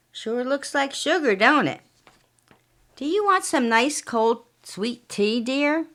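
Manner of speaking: lightly voiced falsely sweet husband; sugary husband. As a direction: sweeten every word to hide the poison. Jack sweet-talks his wife.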